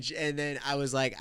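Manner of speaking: ordinary delivery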